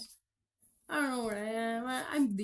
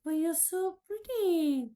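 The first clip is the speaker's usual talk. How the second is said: higher, louder, slow gush; 6 semitones higher, 5 dB louder than usual. Retold or imitated. Telling Jesus in the drawing, a high gushing compliment. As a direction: gush over him adoringly. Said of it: "it's like".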